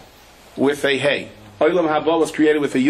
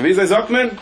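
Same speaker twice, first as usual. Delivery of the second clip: foreign man asking; 7 semitones higher, slower than usual. Retold or imitated. Israeli man asking how to say it, raised in Yiddish. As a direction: ask it in his Yiddish, pitched up. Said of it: "so he said".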